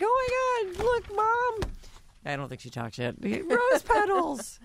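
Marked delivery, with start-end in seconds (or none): 0.0-1.7 put-on very high little-kid voice; excited toddler. Host voices baby Stormy showing Mom, excited toddler squeal. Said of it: "Stormy is in there. She's like". 3.2-4.6 put-on tiny, very high child voice; delighted toddler. Host voices baby Stormy among rose petals, delighted squeak. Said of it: "Stormy is in there. She's like".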